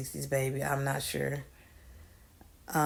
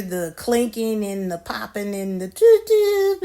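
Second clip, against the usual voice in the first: toot toot noise; 5 semitones higher, 4 dB louder than usual. Retold or imitated. Imitating a sputtering car engine.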